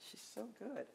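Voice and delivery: hushed, admiring whisper; whispered praise of the poet. Real, not acted. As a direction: murmur it in awe. Admiring aside after the poem.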